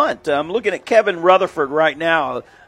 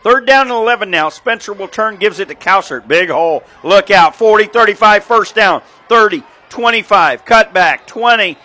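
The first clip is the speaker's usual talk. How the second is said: louder, higher call of a breakaway run; excited play-by-play; 3 semitones higher, 5 dB louder than usual. Real, not acted. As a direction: punch out the yard lines as he runs.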